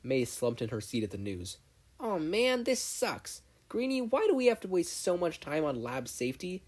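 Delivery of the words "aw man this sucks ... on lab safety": much higher whine; whiny disappointment. Acted. Mei whining to Izuku about lab safety. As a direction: complain like a kid.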